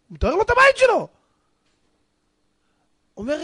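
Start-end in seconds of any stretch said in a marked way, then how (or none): none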